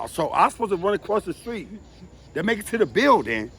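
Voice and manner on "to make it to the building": ordinary talk, nothing marked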